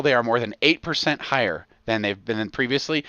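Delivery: usual speaking voice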